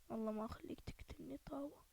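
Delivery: unvoiced menacing whisper; threatening whisper. Real, not acted. Sneaking up on an enemy with a frying pan, whispered threat. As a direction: promise it under your breath.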